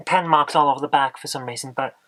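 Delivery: ordinary delivery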